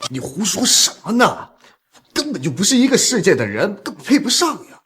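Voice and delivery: high-pitched, indignant protest; flustered denial. Acted. Flustered denial of an affair accusation. (慌忙高声否认)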